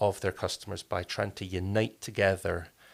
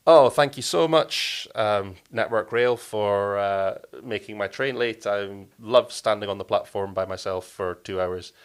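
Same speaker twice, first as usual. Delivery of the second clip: sarcastic; 9 dB louder, slower than usual. Shown as a demonstration. Stranded passenger to rail company over late train, sarcastic. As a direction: lay on the fake gratitude, slow and loud. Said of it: "standard structures of sarcasm".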